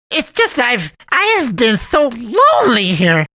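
guilt-trip the boy with mock sadness; sugary high cartoon cat, pleading; pleading cat man. A narrator's reading.

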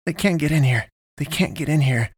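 high, anxious repeating; self-reassuring repetition. A narrator's reading. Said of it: "to assure himself".

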